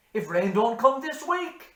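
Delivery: loud, high rising lament; doom-laden farmer. Acted. Hanrahan warning of drought, loud rising lament. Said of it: "said Hanrahan".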